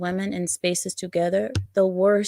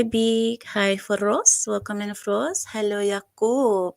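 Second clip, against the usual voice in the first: ordinary talk, nothing marked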